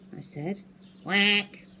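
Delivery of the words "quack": lightly quacking duck voice; boy turned bird quacking down the phone. Acted. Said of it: "said a voice".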